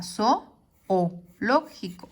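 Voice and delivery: "zo o lógico" broken up with pauses, zo-o-ló-gi-co; "zo o lógico" syllable by syllable; separated vowels. Shown as a demonstration. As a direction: give each o in "zo o lógico" its own beat. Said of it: "sino que separa".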